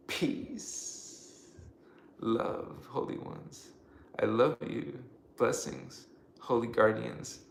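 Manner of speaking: quiet, low benediction in short phrases; soft blessing. Real, not acted. Thanking the holy ones for being here, hushed blessing. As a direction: bless them gently, pausing between words.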